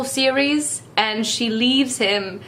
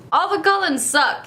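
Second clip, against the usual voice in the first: ordinary talk, nothing marked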